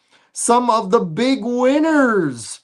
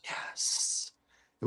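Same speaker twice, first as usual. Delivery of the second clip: whispering; 17 dB quieter than usual. Pretend. Whispered reply to the hush.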